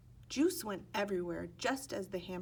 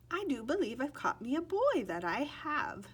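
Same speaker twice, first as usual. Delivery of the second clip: higher, louder Irish leprechaun, pleased with himself; grinning leprechaun; 6 semitones higher, 5 dB louder than usual. Acted. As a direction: gloat over your catch with a grin. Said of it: "said the leprechaun with a grin".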